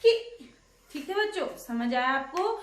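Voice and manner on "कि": कि as short "ki", k plus brief i; syllable-sound demo. Shown as a demonstration. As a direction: teach कि as one isolated syllable. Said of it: "तो क्या बन गया?".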